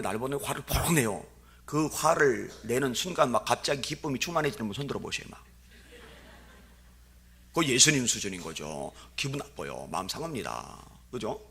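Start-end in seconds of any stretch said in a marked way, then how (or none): none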